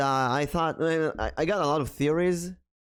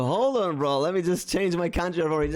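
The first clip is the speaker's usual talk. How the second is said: higher-pitched mock compliance; sarcastic; 5 semitones higher than usual. Streamer mocks complaint about their origin, sarcastic. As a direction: offer it as an absurd, sarcastic fix.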